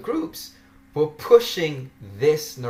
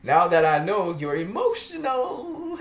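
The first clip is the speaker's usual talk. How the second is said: words laughed out, higher pitched; laughing while mocking the blocked admin; laughing taunt; 6 semitones higher than usual. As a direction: giggle through the gloating. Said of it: "And I'm laughing".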